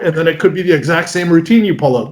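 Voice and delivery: ordinary talk, nothing marked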